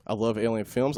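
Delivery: normal talking voice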